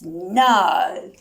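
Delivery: ordinary delivery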